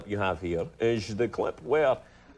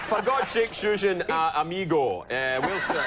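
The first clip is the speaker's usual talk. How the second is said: Scottish Connery voice with a Spanish word; Connery hamming up a Spaniard, laughing. Retold or imitated. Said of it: "as the Spanish guy".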